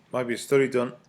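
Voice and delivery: words strained by a sip of water; throat catching after water. Real, not acted. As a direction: voice catching mid-swallow. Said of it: "Sorry, I have to take a drink of water".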